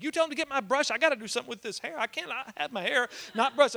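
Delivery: high, rushing imitation of a fussing grandma; fretful fast-talking grandma. Grandma ordering her hairbrush from the hospital bed, fretful. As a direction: rattle it off high as an urgent grandma. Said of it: "going a million miles an hour".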